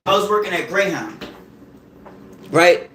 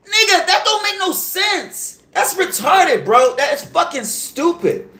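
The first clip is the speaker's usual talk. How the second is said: higher, slow, disgusted disbelief; exasperated rant; 6 semitones higher, slower than usual. Real, not acted. Telling listeners the boss's logic is stupid, slow exasperated rant. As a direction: spell out how dumb it is, fed up. Ranting about the supermarket comparison.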